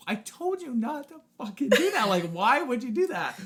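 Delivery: high-pitched imitation of a fed-up mother; mock scolding mom. Mom telling off the child who jumped, mock scolding. Mom's scolding voiced in the story.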